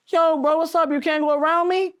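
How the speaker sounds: calling out the window. Retold or imitated.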